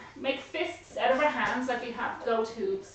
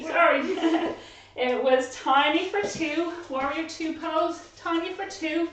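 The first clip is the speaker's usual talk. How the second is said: ordinary talk, nothing marked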